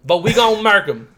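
lightly put-on Southern accent; spot-on Southern accent. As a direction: play it as a Southern good old boy. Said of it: "Your accent is, like, spot on".

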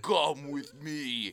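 very slow teacher impression; drawn out like the old teacher. Friends repeating their running joke, slow mock teacher. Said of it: "our long running joke".

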